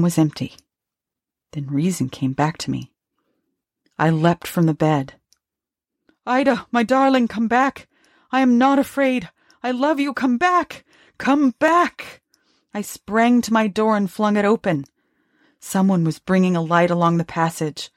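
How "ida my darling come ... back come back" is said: high, urgent pleading; desperate calling. Acted. Husband calls his dead wife back, desperately. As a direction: cry out to her, climbing higher on each come back.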